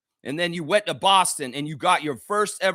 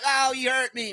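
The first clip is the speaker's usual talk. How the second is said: high, moaning mock complaint; mock whining; 5 semitones higher than usual. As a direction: whine like a hurt player telling on someone. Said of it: "Whine, whine".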